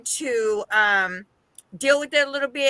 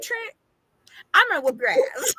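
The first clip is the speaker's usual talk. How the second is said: higher playful threat, breaking into laughter; laughing mock threat; 8 semitones higher than usual. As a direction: threaten the son through giggles. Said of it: "I think I'd be like".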